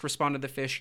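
ordinary delivery, nothing marked

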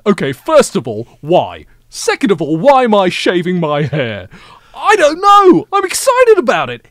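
loud, high, over-eager American act; hammed-up excitement, buzzing his head; excited John Oliver. Playing John Oliver cast as him, loudly excited. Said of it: "you got excited".

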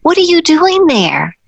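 high, astonished mock question; surprised director. Pat Miri finding the dancer under the table, high mock surprise. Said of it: "and she goes".

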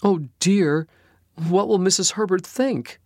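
high, distressed fretting; tearful. A narrator's reading. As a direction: near tears with worry. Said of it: "Phoebe began to cry".

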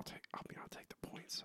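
sheepish whisper to himself. Real, not acted. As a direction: whisper it, a bit embarrassed. Sheepishly accepting the point.

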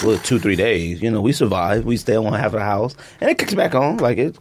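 ordinary delivery

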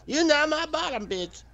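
high squeaky sassy retort. Pretend. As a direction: snap it in a falsetto.

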